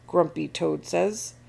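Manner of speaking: ordinary delivery, nothing marked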